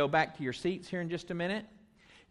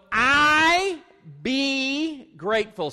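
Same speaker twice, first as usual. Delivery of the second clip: much higher and louder, slow on be grateful; big emphatic call; 7 semitones higher, 6 dB louder, much slower than usual. Shown as a demonstration. Pastor leading children in a loud call. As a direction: proclaim it for all to echo. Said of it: "Say it with me".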